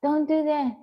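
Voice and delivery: high, loud mock yell at the bunny; imitating a yell. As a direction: copy Yaya shouting at the pet, playfully. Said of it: "I hear Yaya y-, yelling like".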